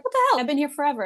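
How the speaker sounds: a sharp high outraged cry of disbelief. Retold or imitated. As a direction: squeal it out, indignant. Outraged at colleague's pay.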